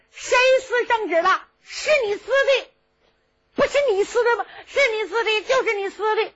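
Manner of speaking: put-on high childish voice throwing blame back; a stubborn kid insisting it was you; boy's high retort, whining at the end; cheeky boy. Boy Qin Ying talks back.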